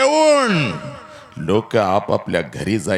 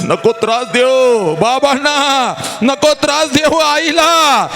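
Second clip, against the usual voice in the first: wailing plea. Acted. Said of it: "तू हंबरडा फोडून भावाला सांगतेस".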